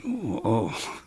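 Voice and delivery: very soft, caught-off-guard murmur; like the wind just left you; quietly taken aback; stunned. Retold or imitated. Stunned by the question.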